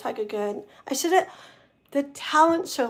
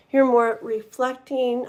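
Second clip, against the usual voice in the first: normal talking voice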